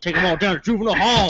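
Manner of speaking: high, loud mock officer; imitated cop. Friend joining the cop bit, high imitation. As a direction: join in on the put-on cop voice.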